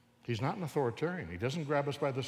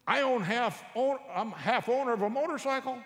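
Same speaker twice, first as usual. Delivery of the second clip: put-on proud teen boast; 9 semitones higher, 3 dB louder than usual. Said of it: "He says".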